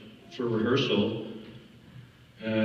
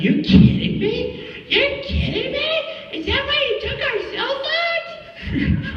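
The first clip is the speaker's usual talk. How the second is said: very high, slow incredulous screech; hammed-up squealed questions; imitated outraged woman; 3 dB louder, slower than usual. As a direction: mimic her shrieking in disbelief. Imitates co-star's disbelief at being stranded.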